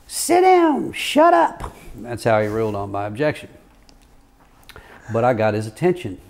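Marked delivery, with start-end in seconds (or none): 0.1-1.7 high, loud mock judge cutting him off; judge snapping. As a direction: bark it like a cranky judge. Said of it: "and he says".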